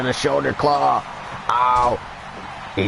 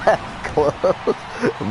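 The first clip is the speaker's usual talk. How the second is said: higher, laughter-tinged amazement; laughing repeat; 5 semitones higher than usual. Real, not acted. Commentator marvels at a near fall, laughing.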